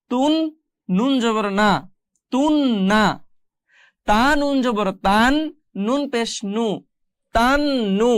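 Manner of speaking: measured spelling, then a held n in "তান না" and "তুন না"; spelling drill. Shown as a demonstration. Teacher models the exercise, measured drill with doubled n in "তান না". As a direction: spell each syllable, then join "তান নু" with a long n. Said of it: "আমরা বাকিগুলো একটু পড়ার চেষ্টা করি".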